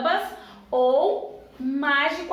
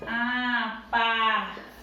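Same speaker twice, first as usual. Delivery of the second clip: "Apartamento" slowly split into a-par-ta-men-to; separated into syllables; 3 semitones lower, slower than usual. Shown as a demonstration. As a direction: draw out each syllable of "Apartamento", with pauses. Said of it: "Vamos lá separar". ("Apartamento" devagar, separando as sílabas)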